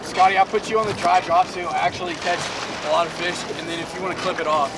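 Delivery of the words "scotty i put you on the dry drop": ordinary talk, nothing marked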